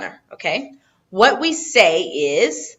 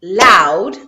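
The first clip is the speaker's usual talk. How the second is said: "loud" as one loud, drawn-out beat; one syllable; 8 dB louder than usual. Shown as a demonstration. Teacher shows a one-syllable word, "loud" in one punched beat. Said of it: "if there's one syllable".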